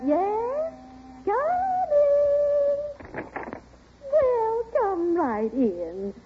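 high, drawn-out door-answering call; lilting, high hostess greeting; welcoming call. Acted. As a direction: sing out a welcome to a visitor. Answering the door to expected guest.

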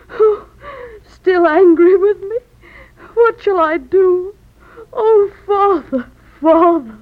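crying, rising grief; tearful lament. Acted. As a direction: weep for a lost father. Daughter mourns father she thinks dead.

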